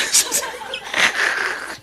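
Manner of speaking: long breathy laugh. Real, not acted. Pastor telling a story on himself, laughing. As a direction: burst into amused laughter. Laughs after his own anecdote.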